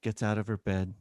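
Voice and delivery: ordinary talk, nothing marked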